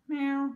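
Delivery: high playful meow to the cat. Real, not acted. Meowing at her cat.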